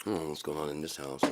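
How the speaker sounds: ordinary delivery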